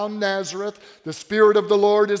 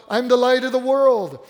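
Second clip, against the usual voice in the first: higher, louder declaration; proclaiming; 5 semitones higher, 6 dB louder than usual. Acted. Jesus to the crowds, bold loud declaration. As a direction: ring it out boldly.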